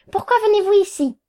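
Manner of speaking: high, quick, irritated question; impatient demand. A narrator's reading. Lawyer to his women clients, impatient demand. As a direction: demand it sharply, pitched well up.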